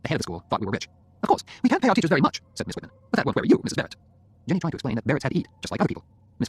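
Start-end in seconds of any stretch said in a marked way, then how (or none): 1.2-2.4 high, louder female official; dismissive headmistress. Acted. School head Miss Whitman speaks. 3.1-4.0 high, louder female official; dismissive headmistress. Acted. School head Miss Whitman speaks.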